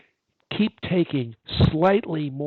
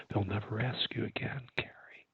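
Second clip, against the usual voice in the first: whispering; 4 semitones lower, 9 dB quieter than usual. Acted. Coach whispering.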